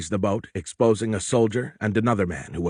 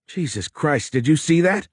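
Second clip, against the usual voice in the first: shocked exclamation; 7 semitones higher than usual. Acted. Sailor shocked by suicide.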